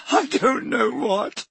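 high, slow tearful voice; sobbing. Acted. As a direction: barely get it out through tears. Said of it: "sobbed Leanne".

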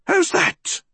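put-on high-pitched hissing old woman; startled, high old-woman hiss. A narrator's reading. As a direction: hiss it high and sudden at an intruder. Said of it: "she hissed".

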